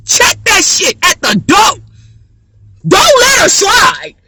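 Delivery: high, loud and slow; high slow shout of don't let her slide; emphatic shout. Real, not acted. Demanding others discipline her daughter.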